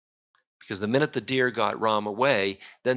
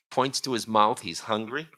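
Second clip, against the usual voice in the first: plain reading voice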